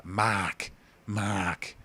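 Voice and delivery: "Mark" with the r dropped, Boston style: "Mahk". Shown as a demonstration. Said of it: "a reference to the Boston accent".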